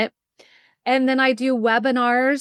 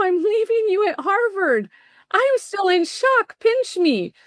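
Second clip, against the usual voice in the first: giddy disbelief; 9 semitones higher than usual. Retold or imitated. Mom leaving son at Harvard.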